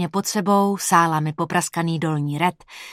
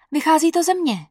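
put-on girlfriend's voice; 9 semitones higher than usual. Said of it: "řekla".

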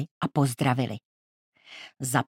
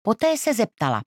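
usual speaking voice

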